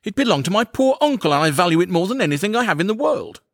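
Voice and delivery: high, aggrieved lament; dazed dismay. A narrator's reading. Going finds his stick destroyed, high and dismayed. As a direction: cry out over a ruined treasure. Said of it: "with a dazed expression and said".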